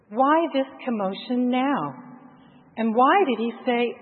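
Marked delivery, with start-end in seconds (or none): none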